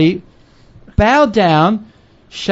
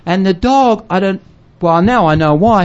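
ordinary talk, nothing marked

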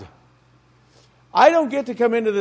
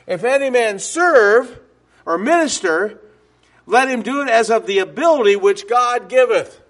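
solemn scripture reading; 5 dB louder than usual. Acted.

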